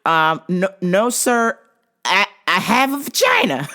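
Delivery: normal talking voice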